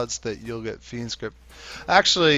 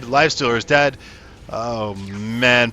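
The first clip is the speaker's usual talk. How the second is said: normal talking voice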